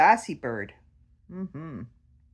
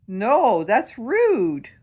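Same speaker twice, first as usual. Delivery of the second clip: higher, louder playful scolding; indignant, raised-voice reprimand in play; mock scolding; 5 semitones higher, 8 dB louder, much slower than usual. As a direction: tell off a cheeky pet bird. Scolding the rude bird.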